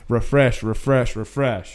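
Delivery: low, soft, repetitive muttering; mock a fan glued to Twitter, under the breath; fans obsessively refreshing. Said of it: "This is gonna be y'all on Friday morning".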